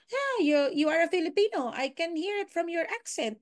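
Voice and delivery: high-pitched mock irate American caller; irate American client. American client calling out a Filipino agent's accent, mock irate. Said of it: "kahit anong irate nung kausap niya, ... Americans".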